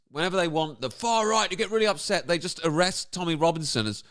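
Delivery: ordinary delivery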